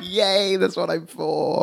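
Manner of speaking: high sarcastic yay; mock cheer. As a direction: cheer it with irony.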